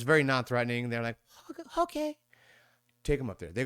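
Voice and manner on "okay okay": okay, okay pitched far up; high mock okay. Family giving in to the stranger: high mock okay. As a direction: imitate the wary family giving in.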